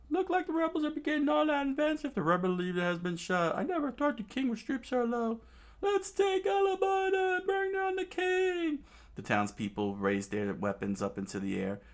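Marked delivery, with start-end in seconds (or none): 0.1-8.8 high, fast mob voice; angry townsfolk. Acted. Townspeople calling to march on the king, high. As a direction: stir up the crowd in a squeaky rush. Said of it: "started screaming out".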